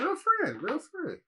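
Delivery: high teasing singsong voice; mocking sing-song. Mocking the friend in the clip.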